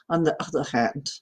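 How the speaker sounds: light Danish accent from father's side. Shown as a demonstration. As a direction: demonstrate a mild Danish accent. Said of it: "we're Danish".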